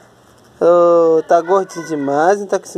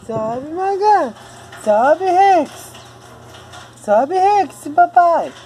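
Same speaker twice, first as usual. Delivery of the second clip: very high-pitched coaxing calls; high cheering on; 13 semitones higher, slower than usual. Real, not acted. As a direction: call the little ones up in a squeaky-high voice. Calling the little ones to climb. (chamando com voz bem aguda)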